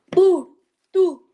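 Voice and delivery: loud, drawn-out shout. Real, not acted. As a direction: call it out proudly. Answering loudly over video call. (a gritar)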